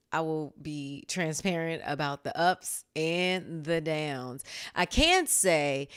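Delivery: usual speaking voice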